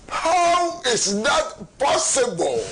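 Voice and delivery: indignant outburst. Real, not acted. Commentator challenges differing pink sheets, indignant. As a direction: draw out an outraged question.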